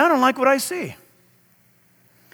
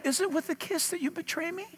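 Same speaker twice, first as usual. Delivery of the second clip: gentle rebuke; 4 semitones higher, 14 dB quieter, faster than usual. Retold or imitated. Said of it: "still said to him".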